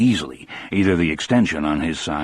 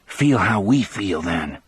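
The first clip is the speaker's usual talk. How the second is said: plain reading voice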